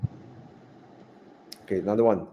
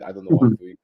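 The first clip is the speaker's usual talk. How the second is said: laughing; faster than usual. Real, not acted. Laughs at his own accent attempt.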